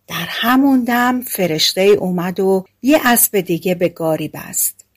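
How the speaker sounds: ordinary delivery, nothing marked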